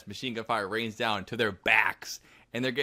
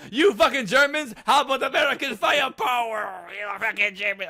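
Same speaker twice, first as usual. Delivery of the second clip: much higher, louder hammed-up taunt; taunting soldier; 11 semitones higher, 5 dB louder than usual. Defector taunting his former comrades, hammed-up shouted jeer. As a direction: play a soldier jeering at the enemy, over the top. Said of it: "you imagine a German guy saying it".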